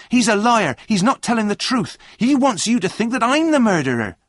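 high, loud indignant outburst; shouted accusation. A narrator's reading. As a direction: protest furiously, voice way up. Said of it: "shouted John".

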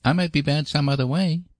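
put-on high little-boy voice, hedging; mildly wary, pitched high; cautious. A narrator's reading. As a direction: add a careful reservation as a small boy. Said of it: "added Davy cautiously".

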